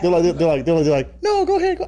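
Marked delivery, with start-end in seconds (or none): 1.2-1.9 high, light imitation of a woman; woman's voice. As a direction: mimic the woman at the door, pitched way up. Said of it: "Your woman voice, by the way, trash".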